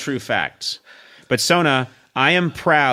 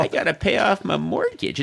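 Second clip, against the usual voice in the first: folksy Midwestern twang, raised pitch; a touch of Carol Channing, folksy; 8 semitones higher than usual. Shown as a demonstration. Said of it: "the late Carol Channing in it".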